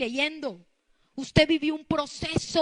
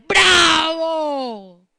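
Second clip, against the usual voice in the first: long, shouted mock cheer; gloating devil; 11 dB louder, slower than usual. The devil applauding a believer's surrender, loud gloating cheer. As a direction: stretch it into a huge mocking hurrah. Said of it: "Y el diablo".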